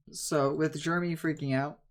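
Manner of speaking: ordinary delivery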